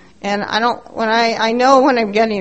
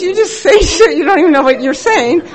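mock yell; 6 semitones higher, 7 dB louder than usual. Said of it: "And he, he says".

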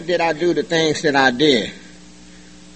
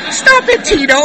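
high [name] squeal; 4 dB louder than usual. Retold or imitated. [name] voice continues joke.

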